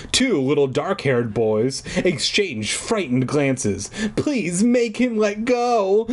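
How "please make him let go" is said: put-on high, drawn-out childish wail. Trapped little girl pleading with her mother, wailing. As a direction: plead slowly in a high whine. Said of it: "Alicia wailed".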